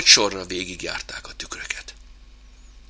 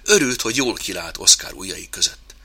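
ordinary delivery, nothing marked

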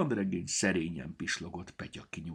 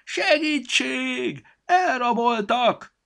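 high, loud, slow squeaky creature shout; shouted plea; 10 dB louder, much slower than usual. A narrator's reading. Storybook in the sack yelling to be let out, high and loud. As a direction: yell to be let out, tiny and shrill. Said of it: "fújta ki az orrát Petya".

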